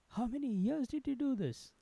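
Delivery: wide-eyed, innocent asking; childlike curiosity. A narrator's reading. Desai asks the forger how long, with childlike curiosity. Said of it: "with absolute childlike curiosity".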